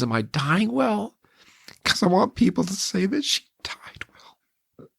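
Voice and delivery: barely holding back tears; tearful whisper. Retold or imitated. Quoting wife, near tears.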